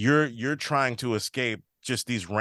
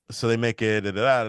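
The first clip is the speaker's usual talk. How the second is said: normal talking voice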